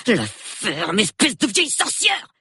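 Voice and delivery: Basil flares up at Miss Marple; flaring up. A narrator's reading. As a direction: snap at the old lady in rage. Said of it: "s'emporta Basil".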